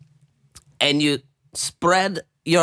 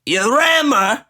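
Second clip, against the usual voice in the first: mock emphatic; 7 semitones higher, 4 dB louder than usual. Hammed-up crude instruction.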